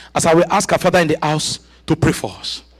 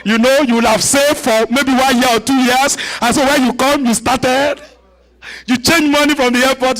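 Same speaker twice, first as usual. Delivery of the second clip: ordinary talk, nothing marked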